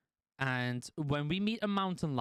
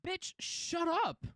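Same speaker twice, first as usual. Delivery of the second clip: slow, high, drawn-out put-down; mocking high snap at the rival; retold insult; 13 semitones higher, much slower than usual. Streamer retells telling off rival roleplayer, high and slow. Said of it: "I literally said to her".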